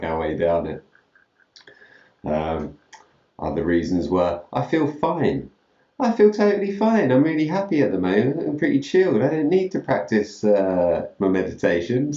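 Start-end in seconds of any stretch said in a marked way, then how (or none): 4.5-12.1 high-pitched, breezy insistence on being fine; hammed-up carefree brush-off, pitched well up; breezy mock excuse. Acts out excuse for skipping meditation.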